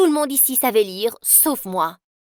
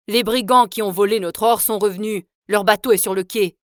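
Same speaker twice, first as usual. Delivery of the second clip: louder, official announcement; a louder soldier reporting to the father; soldier's report; 4 dB louder than usual. Acted.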